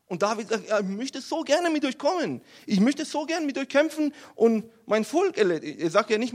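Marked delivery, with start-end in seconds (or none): 0.7-5.8 high, loud, impassioned plea; eager pleading. Acted. David begging to fight alongside the Philistines, eager pleading. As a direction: beg keenly to come along.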